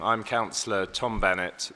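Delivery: ordinary delivery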